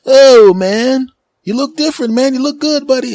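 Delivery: high, loud excited clerk; store clerk voice. Retold or imitated. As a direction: play the hyped-up shop guy calling out. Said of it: "And I go".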